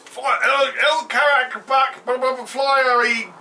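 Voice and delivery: high, loud mock battle yell; hammed-up battle cry. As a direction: shout it like a cartoon hero.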